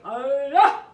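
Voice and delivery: loud karate yell; guttural battle cry. Acted. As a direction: let out a fierce karate cry. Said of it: "With a guttural cry".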